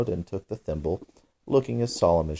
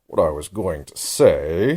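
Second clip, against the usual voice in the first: offended; 5 dB louder, slower than usual. A narrator's reading. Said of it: "said the dodo in an offended tone".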